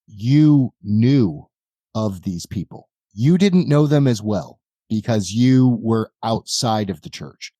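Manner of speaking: normal talking voice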